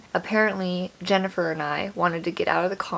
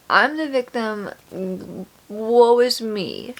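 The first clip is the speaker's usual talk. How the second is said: higher, louder self-pity, slowing at the end; hammed-up poor-me lament; mock victim; 3 semitones higher, 4 dB louder, slower than usual. Teller mocks her teenage victim brain, dramatic self-pity. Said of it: "I'm like, a victim".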